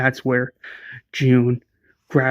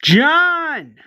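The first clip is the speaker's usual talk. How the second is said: scream; 13 semitones higher than usual. Retold or imitated. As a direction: cry out his name in panic. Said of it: "she screams".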